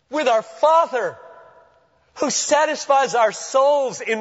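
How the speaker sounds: high, ringing pulpit delivery; urgent proclamation pitched well above normal talk; impassioned preaching. Real, not acted. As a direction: preach it hard in short, high-pitched bursts with pauses. Preaches at full force.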